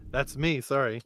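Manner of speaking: normal talking voice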